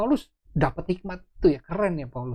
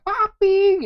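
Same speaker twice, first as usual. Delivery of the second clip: high, loud call to daddy; child's excited yell; 9 dB louder than usual. Retold or imitated. Anak saya runs in to papi, excited yell. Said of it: "langsung teriak".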